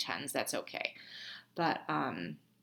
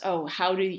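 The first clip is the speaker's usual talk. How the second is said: ordinary talk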